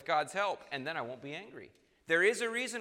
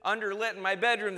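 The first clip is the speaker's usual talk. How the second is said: loud, high petty complaining; hammed-up exasperation over nothing; mock irritation; 6 semitones higher, 6 dB louder than usual. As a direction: whine loudly about a trivial mishap. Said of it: "You're just mildly irritated".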